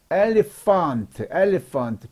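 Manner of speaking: "elephant" said slowly with PH as F. Shown as a demonstration. Teacher demonstrates PH to learners, slow "elephant" with an F sound. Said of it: "피에이치는 F 발음".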